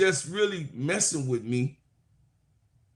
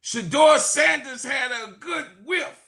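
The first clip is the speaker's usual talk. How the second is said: preaching shout; 11 semitones higher than usual. Real, not acted. Preacher defends Shedeur Sanders, shouting high with a laugh. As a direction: hit the climax, laughing and shouting.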